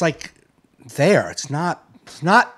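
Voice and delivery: ordinary talk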